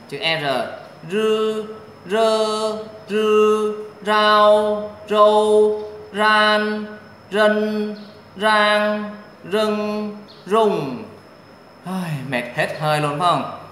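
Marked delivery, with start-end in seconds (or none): 1.1-11.0 high, loud, level syllable chanting; sustained high-level tone on every syllable; syllable drill. Shown as a demonstration. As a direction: hold each syllable high and even, like a pronunciation drill.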